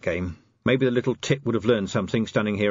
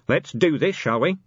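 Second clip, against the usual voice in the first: commander's voice, only moderately raised; commander relaying orders; 5 semitones higher than usual. Acted. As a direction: pass the inspector's proposal on to the sergeant.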